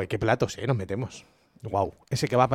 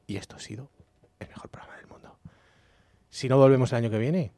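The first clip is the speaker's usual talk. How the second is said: whispering; 5 semitones lower, 9 dB quieter than usual. Real, not acted. Despedida íntima del programa.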